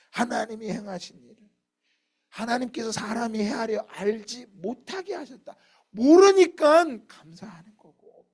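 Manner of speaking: high, loud sermon delivery surging on key words; full-force preaching. Real, not acted. Preacher proclaiming God's unknowable works, full-force preaching. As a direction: proclaim it at the top of the voice.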